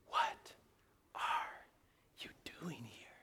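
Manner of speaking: whispered word by word, with pauses; hushed, spaced-out voice of God; gentle whisper. Acted. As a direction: whisper the question very slowly. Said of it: "the sound of a gentle whisper".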